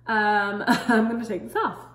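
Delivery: talking through a stiff mask; tags: wearing a sheet face mask. Real, not acted. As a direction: lips held by wet sheet. Seen 3: Wet sheet mask covers her face while she talks, then peels off.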